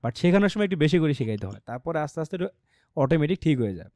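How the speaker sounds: usual speaking voice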